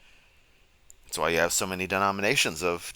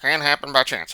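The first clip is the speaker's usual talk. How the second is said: higher, louder sarcastic rebuttal; mocking retort; 6 semitones higher, 5 dB louder than usual. Retorting Kent's claim on chance, sarcastic, higher and louder. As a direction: flip his words back at him, higher and louder.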